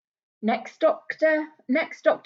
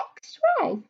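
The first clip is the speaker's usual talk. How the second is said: dramatic reveal. Acted. Dramatic reveal in story read.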